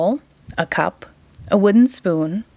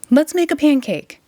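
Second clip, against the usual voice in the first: higher, eager plea; eager boy; 7 semitones higher than usual. A narrator's reading. Jack begs mother for a pancake, eager plea. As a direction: push for the pancake, pitched up. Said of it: "shouted Jack".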